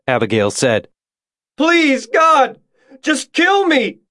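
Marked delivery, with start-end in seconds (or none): 1.6-4.0 very high-pitched wailing plea; agonized scream. A narrator's reading. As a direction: beg in screams of agony. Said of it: "the screams getting louder".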